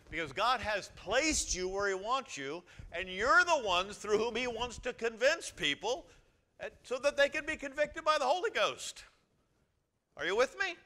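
loud, high, rising preacher's build; preaching at full force. Acted. Pastor rousing the congregation, loud and high. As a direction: drive it home to the whole church.

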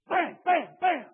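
high, loud mock bangs like a fist on a head; loud banging shouts. Preacher acting out the teller's blows, loud banging shouts. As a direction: belt out each bam like a blow. Teller hits man in story.

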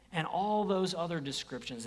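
ordinary talk

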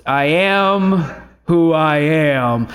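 slow, drawn-out God voice. Shown as a demonstration. Preacher showing the voice he used for God, drawn-out God voice. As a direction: intone it slowly and solemnly. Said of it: "I use that voice".

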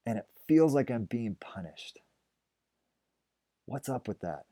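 whispered complaint. Acted. Elijah whispers his complaint to God. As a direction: breathe it out as a confession.

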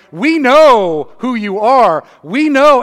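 a hint of a proud teacher; Nicodemus insisting. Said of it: "he was like".

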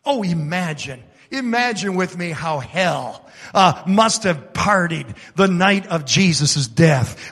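ordinary talk

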